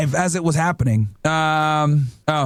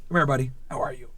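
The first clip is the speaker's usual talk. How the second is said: soft dog talk; 7 semitones lower, 7 dB quieter, faster than usual. Real, not acted. Cooing to his dog.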